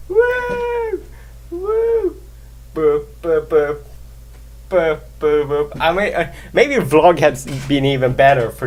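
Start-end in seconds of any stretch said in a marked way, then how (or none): 0.1-2.2 very high, drawn-out imitated whoosh; high "woo" whoop. Vlogger mimicking the mixed-up webcam view, high wooing. 2.7-5.8 short, even "boop" noises; imitated boops. Vlogger playing around with the webcam, imitated boops.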